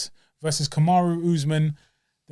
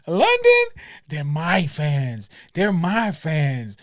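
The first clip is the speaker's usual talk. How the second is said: imitating [name]; slower than usual. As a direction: mimic [name] milking the crowd. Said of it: "[name] was like".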